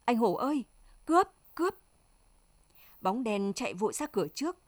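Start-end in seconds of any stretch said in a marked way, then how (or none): 0.0-1.8 voiced cry of alarm, jumping high and loud; frightened yell rising sharply at the end; panicked scream. A narrator's reading. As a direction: call out, then shriek the alarm. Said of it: "Loan kêu thét lên".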